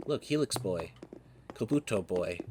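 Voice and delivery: usual speaking voice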